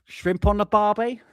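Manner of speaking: high-pitched mock-Australian greeting; mocking Australian accent. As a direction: take the piss with a hammy Aussie accent. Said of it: "take the piss out of, uh, Ross's accent".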